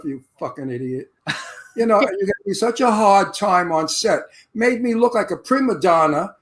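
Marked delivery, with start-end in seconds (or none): 0.0-1.1 said to himself. Retold or imitated. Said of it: "So I said to myself".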